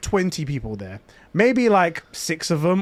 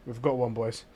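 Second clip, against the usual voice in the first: low, softer trooper radioing the squad; in-character stormtrooper; 6 semitones lower, 10 dB quieter than usual. Retold or imitated. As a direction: play a stormtrooper, low and understated. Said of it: "the stormtrooper's all in character".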